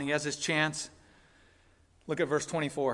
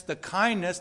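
normal talking voice

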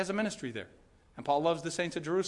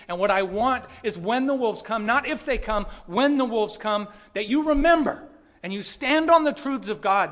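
building louder and higher up to "you stand on the truths of God"; urgent charge; 5 semitones higher, 6 dB louder than usual. Retold or imitated. Voicing Paul's charge to elders.

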